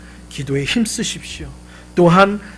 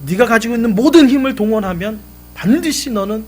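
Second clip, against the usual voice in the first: louder and higher, 모든 hit hard; the father raises his voice to drive the point home; emphatic father; 5 semitones higher, 8 dB louder than usual. Retold or imitated. Father urging his son to use all his strength, emphatic.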